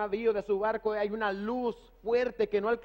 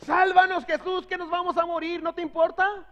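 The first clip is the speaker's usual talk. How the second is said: higher, louder panicked plea; desperate cry; 8 semitones higher, 4 dB louder than usual. Retold or imitated. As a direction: cry out for rescue in a storm. Disciples crying out in storm.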